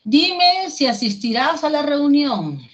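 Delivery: mandato alto y fuerte; léelo como una orden tajante; orden firme. Shown as a demonstration. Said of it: "Es una orden". (en tono de orden, más alto y fuerte)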